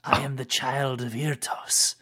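slow lizard voice; hint of a reptile rasp; proud creature claim. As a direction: announce yourself through the door. Creature insists on identity.

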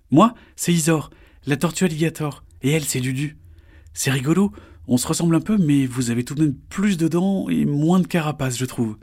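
put-on high, bright young turtle; a small turtle, disgusted; little turtle voice. Isor answers Cyril.

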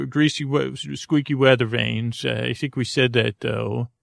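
usual speaking voice

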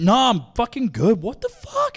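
high, loud reenacted refusal; acted-out shocked refusal, pitch shooting up; alarmed refusal. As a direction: turn it down in squealing disbelief. Retells refusing the offered spray.

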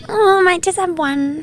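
high, put-on voice of a tame drinker; mock someone timidly settling for one; reluctant drinker impression.

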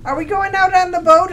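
high, loud mock question; hammed-up eager call; mock excited question. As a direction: shout it like a giddy teen on screen. Quoting movie character.

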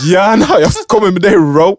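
high, loud, giggly yes; hammed-up eager high voice; mock eager reply. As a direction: gush it at Ro, laughing. Themselves voiced, laughing eager reply.

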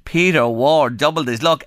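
ordinary talk

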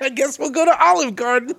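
high, put-on giving-in voice; mock feigned reluctance. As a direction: imitate a friend pretending to give in. Repeats teasing imitation of friend.

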